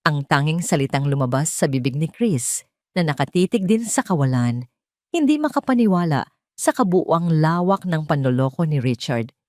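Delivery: plain reading voice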